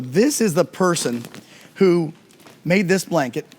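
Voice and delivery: ordinary delivery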